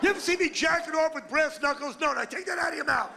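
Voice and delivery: high, loud, shouting comic rant; hammed-up shouting outrage; outraged rant.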